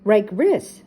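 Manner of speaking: a hint of a dog's garbled yap; eager cartoon dog, lightly voiced; Scooby-Doo voice. A narrator's reading. Scooby agrees with Shaggy.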